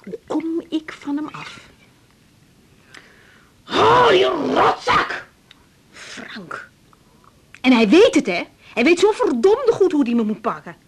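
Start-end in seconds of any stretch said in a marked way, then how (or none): 3.7-6.7 drawn-out, high bitter outburst; bitter curse. Acted. Wife cursing her ex-husband Frank, slow bitter outburst.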